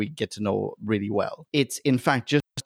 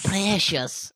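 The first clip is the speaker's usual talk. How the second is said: a hint of Gollum, higher; 6 semitones higher than usual. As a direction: hiss it lightly like Gollum. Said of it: "a proper Gollum".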